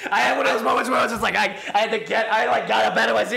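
blurting it out through laughter, swept away; laughing excitedly. Real, not acted. Overwhelmed retelling, laughing.

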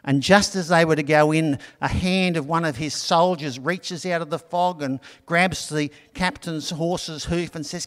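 ordinary talk, nothing marked

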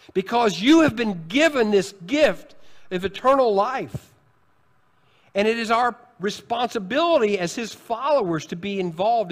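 preaching at full force. Acted. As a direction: drive it home from the pulpit.

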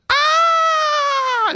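high, loud, hammed-up shriek "Ah!"; mock scream. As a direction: play Eve shrieking at a talking snake. Acting Eve screaming at snake.

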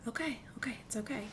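soft whispered comfort, quick; compassionate. Retold or imitated. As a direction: whisper it gently like soothing a friend. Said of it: "like, a compassionate".

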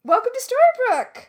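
high, loud show-opener cheer; excited announcement. Pretend. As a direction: shout it like a big welcome. Calling out the podcast's name.

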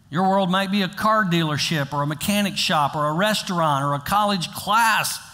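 ordinary talk

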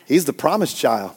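a hint of a boastful father, hushed; proud father. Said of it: "He's, like, probably going around".

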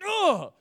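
loud, high disgusted "ugh"; frustrated groan. Retold or imitated. Pastor acting out hitting a door with new shoes, loud groan. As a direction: let out a fed-up groan at the scuff. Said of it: "you're just like".

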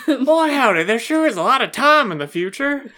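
hammed-up Southern accent, laughing. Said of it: "making fun of Southern accents".

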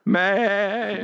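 high, stretched-out imitation of "man"; drawn-out "man". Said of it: "the word man ... seven and a half syllables".

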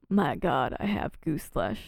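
lightly imitated low, understated captain; Holt. Host quoting Holt's odd phrase, low understated imitation. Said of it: "Holt saying".

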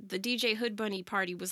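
ordinary delivery

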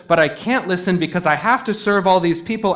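mock exasperated Martha; Martha's protest. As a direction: plead busyness like a harried hostess. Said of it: "Martha says".

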